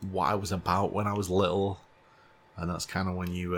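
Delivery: ordinary delivery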